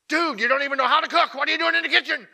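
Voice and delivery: loud, high, fast scolding; angry chef tirade. Acted. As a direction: lay into a clueless cook at full volume. Acting out an angry show chef.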